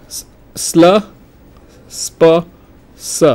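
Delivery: "sl", "sp", "s" each sounded alone, with pauses; isolated syllables. Shown as a demonstration. Teacher picking out stressed syllables, "sl", "sp", "s" said separately. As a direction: pick out "sl", "sp" and "s" one by one. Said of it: "are stressed syllable".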